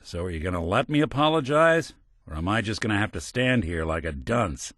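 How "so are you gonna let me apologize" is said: normal talking voice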